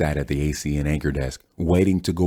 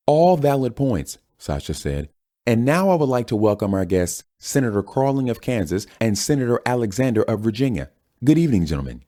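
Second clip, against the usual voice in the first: news anchor voice; 7 semitones higher than usual. Acted. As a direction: smoothly move on like a TV host. Anchor moves to senators.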